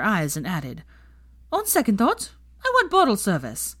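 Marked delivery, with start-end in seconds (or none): 1.5-3.8 high, spoiled demand; haughty demand. A narrator's reading. Pansy demands bottle service, high and haughty. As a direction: lay it on like a diva.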